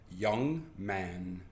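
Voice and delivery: low, measured scolding address; stern mother. Acted. Mother addressing her son sharply, stern. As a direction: say it low, as a mild warning. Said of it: "if she's very, very mad".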